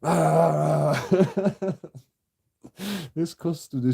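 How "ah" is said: a sudden yelled "Ah!" mocking the officer; mock outburst. Mocking the police kicking off, a yelled "Ah!". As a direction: bark out a cartoonish "Ah!". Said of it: "you have to go".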